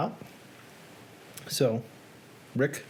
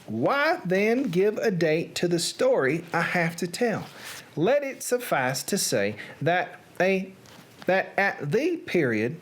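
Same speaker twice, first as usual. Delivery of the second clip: put-on Southern accent. Reader performing Edgar Allan Poe's tale for an audience, Southern accent. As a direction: read it out as a Southern gentleman narrator. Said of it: "Edgar Allan Poe in a Southern accent".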